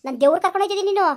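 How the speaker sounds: fast, loud, furious outburst; angry shout. Acted. Daughter-in-law shouting at her mother-in-law, fast and furious. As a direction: snap back hard and fast.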